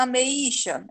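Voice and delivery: "ameixa" said wrongly as a-me-I-xa; separated vowels. Shown as a demonstration. Teacher mocks asking for a plum, "ameixa" with E and I split. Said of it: "aí ficaria separado".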